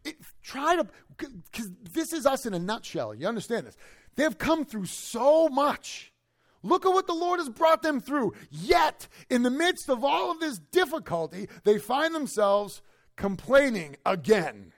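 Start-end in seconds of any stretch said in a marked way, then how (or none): 4.1-14.7 high, loud preacher's cry, slow at first; preaching at full force. Acted. Pastor urging congregation about Israel's trials, full-force preaching. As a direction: lift the congregation to a shout.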